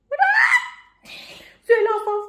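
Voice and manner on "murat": calling out. Real, not acted. Calling out to Murat.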